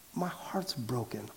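soft, slow confession of grief; hushed and slow, as a broken-hearted woman; heartbroken. Acted. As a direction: quietly pour out a broken heart. Voicing heartbroken Hannah praying.

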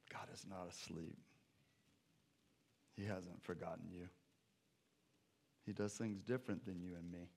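soft, low reassuring voice; gentle reassurance. Real, not acted. Preacher comforting the congregation, soft and tender. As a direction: comfort them quietly.

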